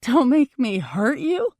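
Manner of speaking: loud, slow, incredulous echo; yelling. A narrator's reading. Girlfriend yelling his words back at him, outraged. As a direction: throw his words back, high and rising. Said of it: "I yell, grabbing the sides".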